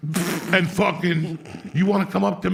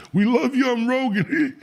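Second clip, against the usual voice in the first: high, gushy fanboy voice, much higher; mock gushing fan; 10 semitones higher, 4 dB louder than usual. Fans crowding him at a bar, in a high gushing mock voice. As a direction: squeal it like a starstruck fan.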